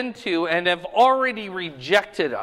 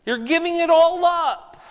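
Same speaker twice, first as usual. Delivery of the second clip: higher, pleading, heartbroken; heartbroken plea; 9 semitones higher, 4 dB louder than usual. Retold or imitated. Jesus pleading with cities that reject him, heartbroken. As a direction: beg them not to throw it away. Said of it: "He's not mad at them. He's like".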